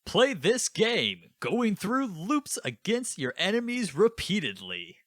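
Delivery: very high, loud, drawn-out hype voice; mock game presenter. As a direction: ham up a game pitch, slowly and way up high. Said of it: "guy come out".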